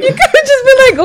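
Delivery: fast, high, laughing outburst; laughing protest. Real, not acted.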